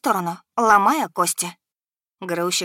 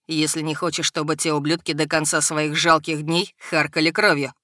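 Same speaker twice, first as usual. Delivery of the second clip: low, barely held-back rage; restrained fury dragon; 4 semitones lower than usual. A narrator's reading. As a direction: growl it through clenched teeth. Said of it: "громко заскрежетал зубами". (сдерживаемая ярость дракона)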